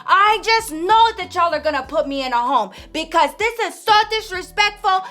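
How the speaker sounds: high, loud crying complaint; a hammed-up wail of a wronged mother; wailing protest. Grandma wails at her daughters as they pull her purse.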